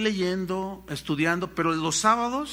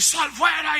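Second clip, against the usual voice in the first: mock command shout; 7 semitones higher, 4 dB louder, slower than usual. Exorcists commanding the demon.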